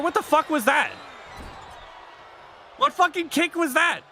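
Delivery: usual speaking voice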